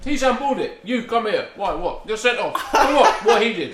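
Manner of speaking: high, loud, excited back-and-forth; hammed-up argument, laughter breaking in; mock referee row. Acting out a referee's muddled sending-off, hammed-up protest.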